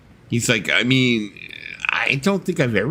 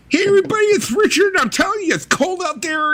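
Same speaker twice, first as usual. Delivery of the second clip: Richard impression; faster than usual. Friend imitating Richard's video greeting, very high. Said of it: "It would be like".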